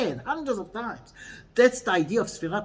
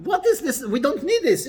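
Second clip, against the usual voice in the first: mock indignation; 7 semitones higher, 4 dB louder than usual. Mock objection to the verse.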